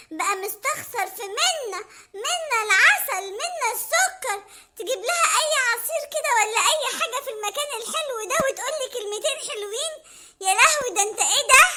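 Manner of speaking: giggly Mona. Acted. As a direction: sweet-talk him for a juice. Mona teasing Hamada.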